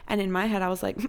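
ordinary talk

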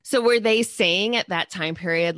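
ordinary talk, nothing marked

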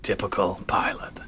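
low, hushed whisper; muttered jibe. Acted. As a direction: mutter it under your breath about a crewmate. Muttered in-character jibe at the pilot.

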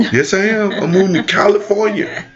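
put-on high childish insistence; insistent kid. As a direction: whine it like a stubborn little boy.

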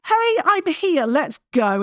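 high, slow hello from motivation; motivation personified. Retold or imitated. Motivation itself turning up to greet the lazy viewer, high and slow. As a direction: greet them like motivation arriving at last. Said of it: "wave its hand at you and say".

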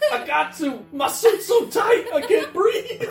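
high, loud laughing mock Juggernaut yell; high laughing shout. Quoting the Juggernaut meme to friends, high laughing mock shout. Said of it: "And he's like".

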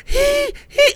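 high squeaky mock panting; mock wheezing. Mocking a weak climber on the stairs, high squeaky wheeze. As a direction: squeak like someone out of breath. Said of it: "I wasn't like".

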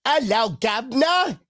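high, slow Cockney greeting; hammed-up London accent; mock British accent. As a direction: draw it out in a cartoon British accent. Jokes about London trip, mock British.

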